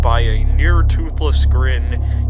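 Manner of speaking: dramatic storyteller reading over music; story narration. A narrator's reading. Narrator reading a shoe's story to viewers, dramatic. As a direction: narrate it like a grim tale.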